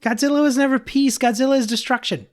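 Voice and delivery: high, worked-up protest; getting all loud. Acted. Zen garden owner insisting to his friends, loud and high. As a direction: pitch way up, calm slipping away. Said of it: "why is your voice getting all loud and shit".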